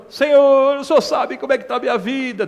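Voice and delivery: imitating a crying believer; mock whiny prayer. Imitating whining believer praying. (oração chorosa imitada)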